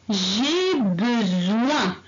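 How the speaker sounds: J'ai besoin, each syllable drawn out slowly; slow, clear teacher demonstration; slow model reading. Shown as a demonstration. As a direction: read it slowly for the class to copy. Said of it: "Alors, qu'est-ce qu'on va dire?".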